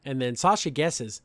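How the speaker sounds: normal talking voice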